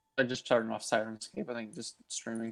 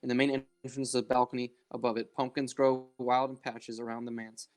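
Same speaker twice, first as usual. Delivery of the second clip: scene narration. Acted. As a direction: set the scene, unhurried. GM scene setting.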